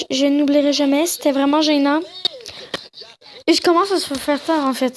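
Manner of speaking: ordinary talk, nothing marked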